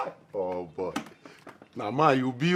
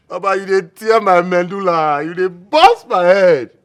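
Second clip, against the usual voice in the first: excited; 4 semitones higher, 11 dB louder than usual. Acted. Friend overwhelmed by the news.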